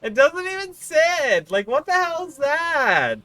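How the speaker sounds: high, loud, slow indignant whine; exasperated whine. Real, not acted. Streamer complaining that the turkey hat won't sit, whining. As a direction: complain like the hat betrayed you.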